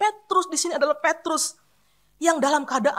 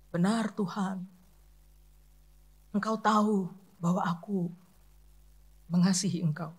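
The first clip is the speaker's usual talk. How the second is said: humble quiet Peter; 8 semitones lower, 7 dB quieter than usual. Retold or imitated. Preacher voicing Peter's humble reply to Jesus, soft and ashamed. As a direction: say it low and ashamed, barely above a breath. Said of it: "Petrus menjawab begini".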